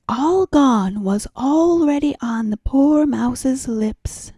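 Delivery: plain reading voice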